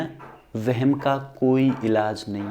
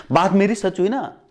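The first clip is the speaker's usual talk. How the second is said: usual speaking voice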